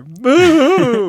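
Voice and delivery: very loud, high mock boo; playful high-pitched heckle; imitated cracking boo. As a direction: exaggerate a voice crack on a long boo. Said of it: "You got that voice crack in there".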